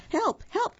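very high cat's cry for help; meowing cry. A narrator's reading. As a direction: squeal it high like a scared cat. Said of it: "meowed Cat".

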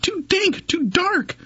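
high tiny squeaking mouse voice; squeaky mouse. A narrator's reading. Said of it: "Mouse squeaks".

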